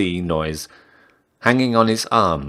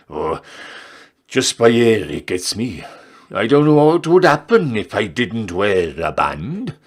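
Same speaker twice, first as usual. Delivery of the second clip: put-on old man voice; 3 semitones higher, 5 dB louder than usual. Old customer complains of cold.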